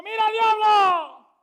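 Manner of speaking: high, loud, slow commanding shout; preacher yelling a rebuke at the devil. Retold or imitated. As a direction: thunder at the devil. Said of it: "duro le grité yo".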